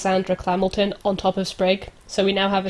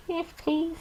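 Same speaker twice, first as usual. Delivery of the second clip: high, soft, shy squeak; embarrassed small voice; 8 semitones higher, 13 dB quieter than usual. Acted. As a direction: mumble it shyly, very high. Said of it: "She looks embarrassed and goes".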